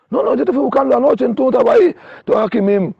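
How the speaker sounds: high-pitched retold reprimand; acted scolding. Man telling him he was missing last night, mimicked scolding.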